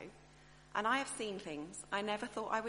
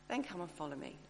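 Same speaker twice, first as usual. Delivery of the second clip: a lower, softer, stern command; stern Jesus; 4 semitones lower, 4 dB quieter than usual. Retold or imitated. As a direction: say it like a cold order, dropping down. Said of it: "I might sort of say".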